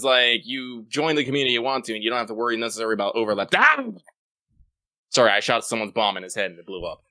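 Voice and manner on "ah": sudden high, loud yelp; short startled shout. Real, not acted. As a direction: cry out as something blows up. Startled by game bomb blowing up.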